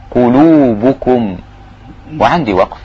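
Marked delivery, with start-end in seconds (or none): 0.1-1.4 قلوبكم with every vowel rounded on the lips, slowly. Shown as a demonstration. Teacher models the rounded vowels of قلوبكم. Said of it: "كلها مضمومة هي من الشفايف".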